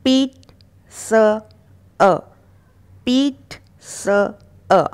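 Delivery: पीट स अ in slow separate pieces; sound by sound. Shown as a demonstration. Teacher breaking pizza down, slow separated पीट स अ. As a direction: say "peet", pause, "sa", pause, "a". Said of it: "देख सकते हैं आप इसका सही उच्चारण".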